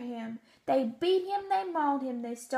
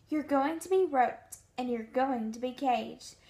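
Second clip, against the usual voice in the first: usual speaking voice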